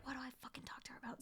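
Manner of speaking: fast panicked whisper to herself. Retold or imitated. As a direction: hiss it under your breath, rushed. Inner panic meeting Warren.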